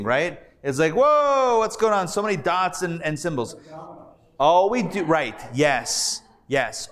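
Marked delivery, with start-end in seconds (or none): 0.9-3.5 mock dizzy bewilderment. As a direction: act out a learner overwhelmed by it all. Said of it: "it looks a little dizzying".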